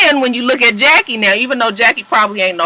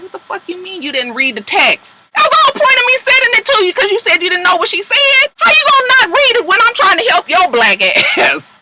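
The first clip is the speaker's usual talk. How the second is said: high, loud mock tirade, pitched way up; imitating Tammy yelling; 12 semitones higher, 4 dB louder than usual. Recapping a reality show fight, imitating an outraged Tammy screeching at Jackie. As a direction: shriek it out as an outraged friend.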